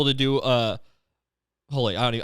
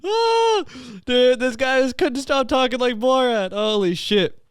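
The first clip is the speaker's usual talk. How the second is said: high-pitched, breaking up with real laughter; cracking up; 10 semitones higher, slower than usual. Real, not acted. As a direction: squeal it out while still laughing. Cracking up at his own story.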